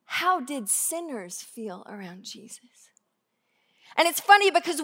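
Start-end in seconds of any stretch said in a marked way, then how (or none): 0.0-2.6 hushed whispered question; whispered rhetorical question. Real, not acted. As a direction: drop to a whisper to draw them in.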